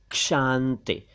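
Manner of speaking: क्षांति begun with k plus sh, "kshanti"; correct ksh onset. Shown as a demonstration. Teacher correcting learners: क्षांति as "kshanti". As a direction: say क्षांति with a clear k before sh. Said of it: "शांति भी नहीं".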